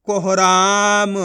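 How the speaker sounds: कोहराम very slow, with long रा; blended word reading. Shown as a demonstration. Teacher reading the joined word, slow blending. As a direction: stretch को-ह-राम into one word. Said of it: "आपस में लाके पढ़ दो".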